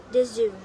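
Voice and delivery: "the zoo" for "just to", T softened towards a D; flapped T. Shown as a demonstration. Teacher showing American flap, "just to" softened into "the zoo". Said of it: "where the T become a soft".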